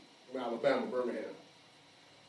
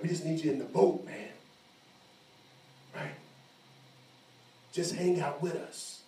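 coaxing negotiation; 3 dB quieter than usual. Retold or imitated. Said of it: "trying to negotiate".

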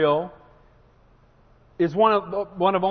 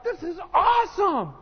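high, shouted delight; over-the-top excited exclaiming; overjoyed; 9 semitones higher than usual. Retold or imitated. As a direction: burst out with thrilled joy. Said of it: "I would be like".

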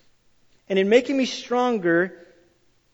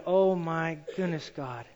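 humble prayer; 5 semitones lower, 8 dB quieter than usual. Retold or imitated. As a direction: pray it quietly and humbly. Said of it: "Instead of going".

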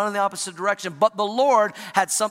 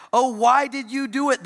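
groaned; 6 semitones higher, 4 dB louder than usual. Retold or imitated. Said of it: "they groaned".

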